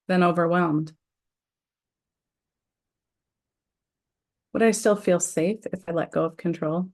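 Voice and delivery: measured, deliberate affirmation read with pauses; guided tapping script. Acted. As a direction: lead the group through each setup phrase. Guides tapping round phrases.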